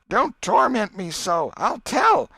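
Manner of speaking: put-on a boy begging in a nightmare, rising to a cry; Tom pleading in sleep.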